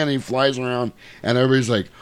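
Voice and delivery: usual speaking voice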